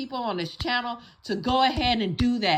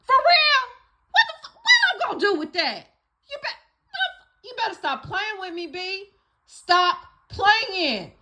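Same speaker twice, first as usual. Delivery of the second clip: mock outrage; 7 semitones higher, 4 dB louder than usual. Mock outrage at cheating man.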